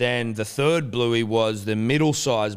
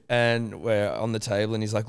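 normal talking voice

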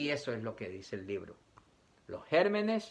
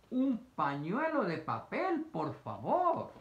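polite request; 6 semitones higher, slower than usual. Shown as a demonstration.

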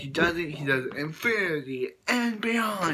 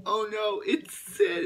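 high, panicked squeal of "it's Sid"; terrified toy sees Sid next door; frightened cry; 9 semitones higher, 4 dB louder, slower than usual. Retold or imitated. Toys spot the scary neighbor.

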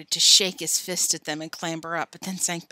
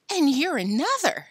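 higher, louder sneer; 7 semitones higher, 5 dB louder than usual. Acted. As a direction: spit it back with contempt. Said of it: "sneered Billy through clenched teeth".

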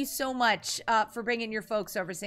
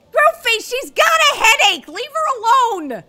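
much higher, louder mock scolding; protective shout; 12 semitones higher, 12 dB louder than usual. Real, not acted. Streamer yells at Groomfee to leave Moo alone. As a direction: shout to defend her.

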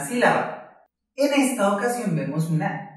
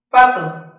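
"Pato" with a loud, high "pa"; stress on PA; 4 semitones higher, 9 dB louder than usual. Shown as a demonstration. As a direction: punch the "pa" of "Pato" hard. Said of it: "esta es la sílaba que más fuerte nos suena". ("Pato" con "pa" muy fuerte)

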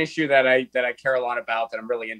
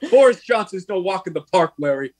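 mock brotherly bickering; 5 semitones higher, 3 dB louder than usual. Acted brothers' quarrel.

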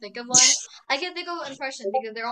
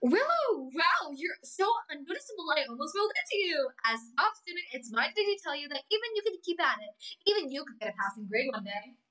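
higher, haughty mean-girl cartoon voice; Amity Blight impression; 4 semitones higher than usual. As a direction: sneer it like the snobby top student. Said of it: "Season one Amity".

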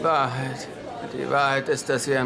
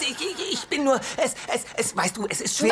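flustered stammer; 10 semitones higher than usual. Acted. Flustered excuse after accusation.

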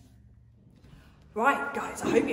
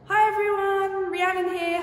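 presenter greeting; 11 semitones higher, 5 dB louder than usual. Real, not acted. Retake of greeting.

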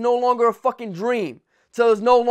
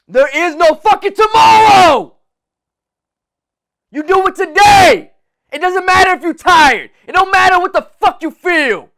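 much higher, much louder roar; motivational shout; 8 semitones higher, 10 dB louder than usual. Real, not acted. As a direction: bellow it at the listener. Motivational peak.

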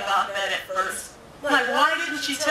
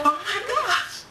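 high, thrilled cry of recognition; overjoyed disbelief; 11 semitones higher than usual. Retold or imitated. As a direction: gasp it out, overwhelmed with joy.

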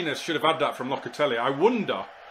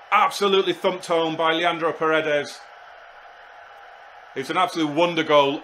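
louder, raised pitch, hyped goal call; excited commentary; 3 semitones higher, 5 dB louder than usual. Real, not acted. Commentating his own wonder goal.

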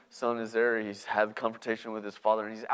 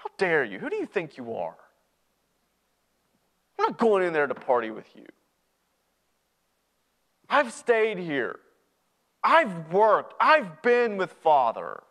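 raised, outraged voice with heavy pauses; angry rejection; 6 semitones higher, 6 dB louder than usual. Acted. Older brother rejecting his returned brother, angry. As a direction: push him away in indignation. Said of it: "I'm angry".

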